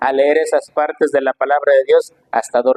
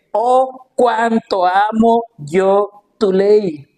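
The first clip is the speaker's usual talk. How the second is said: slow, drawn-out fervent exclamation, pitched up; exclaiming; 4 semitones higher, much slower than usual. Retold or imitated. Preacher voicing David's love for God's law, slow fervent exclaiming. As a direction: cry it out with longing. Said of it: "David exclama y dice".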